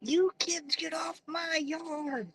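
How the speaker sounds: put-on slow, high, thin old-man voice; a slow mock geezer telling the kids to get off his yard; grumpy old man. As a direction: play a cranky old neighbour shooing kids off the lawn.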